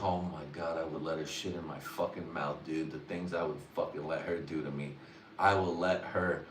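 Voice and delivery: low, soft confiding tone; hushed to his boy. Retold or imitated. Telling his boy about a girl, low and hushed. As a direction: lean in and say it low. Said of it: "be like".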